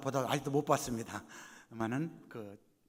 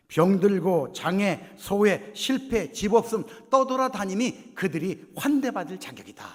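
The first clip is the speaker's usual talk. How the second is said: loud, higher, item-by-item build; emphatic preaching; 7 semitones higher, 11 dB louder, slower than usual. Acted. Preacher listing the outcasts to the congregation, emphatic and loud. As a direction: preach each hardship out with force. Preaches a list at full force.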